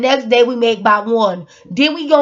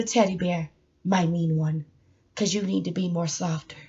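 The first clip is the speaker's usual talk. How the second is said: gentle coaxing; 7 semitones lower, 8 dB quieter than usual. Acted. Handing a teddy bear gently.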